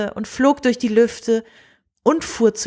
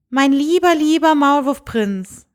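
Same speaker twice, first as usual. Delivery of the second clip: tender princess; 6 semitones higher, 3 dB louder than usual. Acted. Princess tenderly turning down the mole prince, higher voice. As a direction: let the princess soften on lieber.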